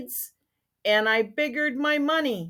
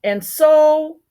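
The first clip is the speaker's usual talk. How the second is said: loud Lorax voice; 6 semitones higher, 9 dB louder than usual. Acted. Lorax voice in read story.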